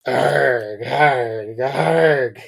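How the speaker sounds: loud anguished arg cries; screaming. A narrator's reading. Boy screaming as the train bears down, loud cries. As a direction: howl out in pain. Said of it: "he screamed".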